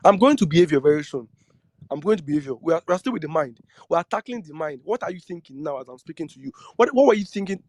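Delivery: ordinary talk, nothing marked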